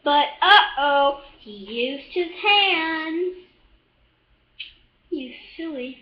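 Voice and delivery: usual speaking voice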